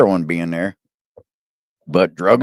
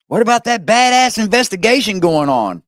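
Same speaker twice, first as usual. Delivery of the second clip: mock; 8 semitones higher than usual. Real, not acted. Mocking question retold.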